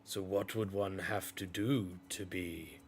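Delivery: low, quiet, measured pirate boss; hushed low-pitched pirate leader; pirate character. Acted. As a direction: ask it low and soft, drawing out the title. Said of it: "he kind of goes".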